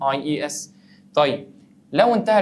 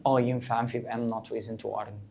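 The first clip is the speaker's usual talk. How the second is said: quiet answer; 4 semitones lower, 8 dB quieter than usual. Acted. Teacher answers a student quietly.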